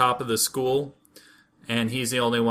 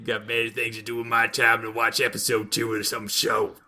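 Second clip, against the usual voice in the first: lightly put-on gruff co-host voice; a hint of mocking impersonation; mocking co-host imitation; 3 semitones lower than usual. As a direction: imitate your co-host's dismissive grumbling. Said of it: "That's what you sound like".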